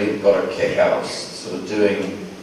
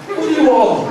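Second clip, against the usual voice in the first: incredulous; 6 dB louder than usual. Retold or imitated. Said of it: "everyone went".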